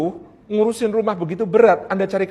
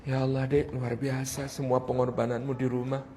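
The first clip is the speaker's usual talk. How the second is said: very soft, low admiring husband; hushed, tender praise for the wife; empati suami; 9 semitones lower, 14 dB quieter than usual. Shown as a demonstration. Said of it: "Itu yang diharapkan kemudian". (pujian suami lirih penuh empati)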